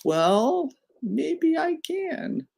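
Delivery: very high, drawn-out put-on voice; sing-song high, stretched-out comeback; teasing retort. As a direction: tease the opponent in a slow falsetto. Player's acted retort to opponent.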